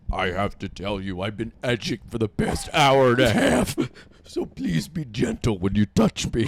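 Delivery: comically deep, solemn robot-cop voice; imitating a deep-voiced stiff lover; RoboCop. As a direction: put on a very low machine-man baritone. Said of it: "RoboCop deep voice".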